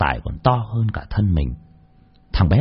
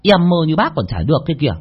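cheerful rough laborer; 9 semitones higher than usual. A narrator's reading. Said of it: "vui vẻ bảo".

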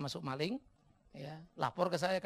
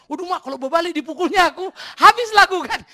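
very loud, high mock panic; panik; 12 semitones higher, 18 dB louder than usual. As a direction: cry out in comic alarm at your own danger. Menceritakan panik sendiri menghadapi dua maling. (seruan panik, keras dan tinggi)

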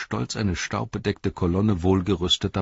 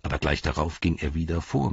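plain reading voice

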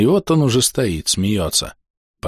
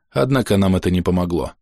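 ordinary delivery, nothing marked

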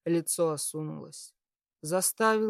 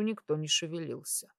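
usual speaking voice

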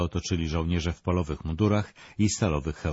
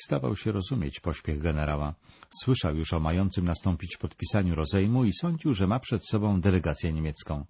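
usual speaking voice

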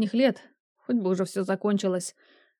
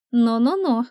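louder, teasing little scolding; playful warning; 3 semitones higher, 5 dB louder, slower than usual. A narrator's reading. Fiancée slips out of his hands, playfully scolding. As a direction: tease him with a mock telling-off.

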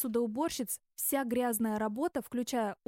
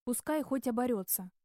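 ordinary delivery

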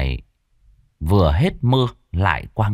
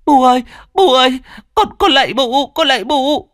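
put-on high, loud wailing child begging; screaming plea; 5 dB louder than usual. A narrator's reading. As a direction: scream for mercy in pain. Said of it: "gào thét đau đớn cầu xin".

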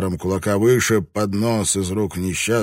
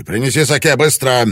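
loud, higher snapped command; barked order; 7 semitones higher, 8 dB louder than usual. A narrator's reading. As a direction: bark it at the servant. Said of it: "рявкнул на служанку".

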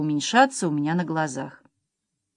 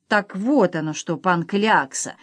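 ordinary delivery

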